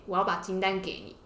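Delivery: normal talking voice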